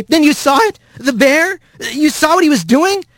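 high, excited questioning; incredulous, high-voiced questions; astonished Rat. A narrator's reading. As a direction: pitch it way up, eager for confirmation. Rat urgently questions the rabbit.

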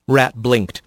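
ordinary delivery

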